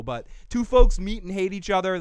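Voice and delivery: usual speaking voice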